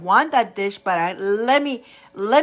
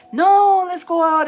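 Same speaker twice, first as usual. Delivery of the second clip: friends protesting; 8 semitones higher, 6 dB louder than usual. Retold or imitated. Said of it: "friends reply".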